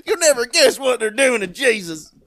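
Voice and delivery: high, hollered Deep South yokel; Deep South accent. Acted. Deep South child calling Ma about Jesus, shouted Southern accent. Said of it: "it was folk from the Deep South".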